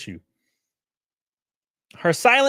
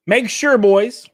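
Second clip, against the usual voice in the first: calling out; 7 semitones higher, 7 dB louder, much slower than usual. Real, not acted. Calling out to viewers.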